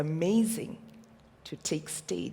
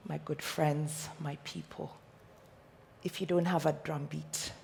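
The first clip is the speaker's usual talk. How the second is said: normal talking voice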